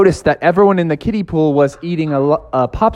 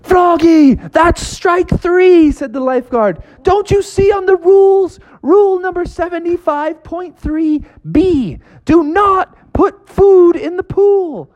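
very high, slow shouting lifeguard; angry lifeguard; 13 semitones higher, slower than usual. Acted. Lifeguard kicks Froggy out.

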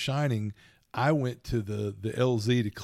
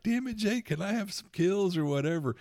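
much higher, whiny complaint; mock whine; 8 semitones higher than usual. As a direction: whine at the kid hogging the kills. Father's mock complaint to son.

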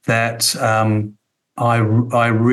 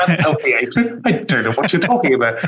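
higher, laughing mock denial; mock innocence; 5 semitones higher than usual. Guest teasing the host with feigned innocent denial. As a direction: play dumb with a laugh. Host jokes about Aussie hatred.

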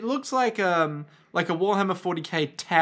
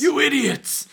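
mock yell; 9 semitones higher, 5 dB louder, slower than usual. Voicing cop yelling at colleagues.

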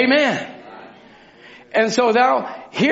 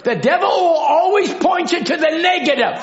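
preaching shout; 11 semitones higher than usual. Real, not acted. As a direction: cry it out to the congregation. Preacher declaring to congregation.

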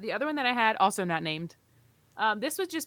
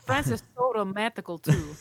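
put-on a botched French accent that drifts toward Russian, a bit louder; mock French accent; 5 dB louder than usual. Cohost hams up a French accent about romantic France, mock French accent. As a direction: lay on a jokey fake French accent with a laugh. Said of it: "Wait, that didn't sound French".

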